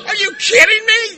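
high, loud mock disbelief; incredulous shout. Sponsee reacts to his sponsor's answer, shouting in disbelief. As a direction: cry out as if you can't believe it.

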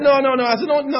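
mock argument. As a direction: push back hard, half laughing. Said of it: "we got into this argument".

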